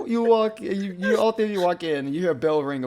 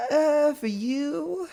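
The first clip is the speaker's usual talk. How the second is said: much higher, very slow drawn-out pause; sly shopkeeper; 4 dB louder, much slower than usual. Acted. Shopkeeper pricing glasses for the fae customers, slow and sly. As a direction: savour the price before naming it.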